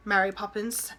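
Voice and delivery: ordinary delivery, nothing marked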